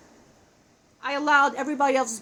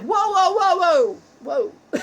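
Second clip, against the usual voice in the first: louder, higher astonished whoas; amazed whoa; 6 semitones higher, 7 dB louder, slower than usual. Retold or imitated. Seeing his successful past lives, loud amazed whoas. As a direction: react to seeing a better life. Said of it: "I'm like".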